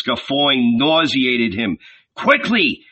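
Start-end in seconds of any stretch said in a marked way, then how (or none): 2.1-2.8 first urge pitched high; urgent exhortation. Acted. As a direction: snap "Quickly" out higher. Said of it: "he exhorted his companions".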